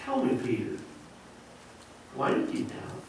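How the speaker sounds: soft, near-whispered invitation to reflect; quiet, caring question; gentle teaching question. Acted. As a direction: ask Peter kindly, like a patient teacher. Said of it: "maybe Jesus was trying to teach him".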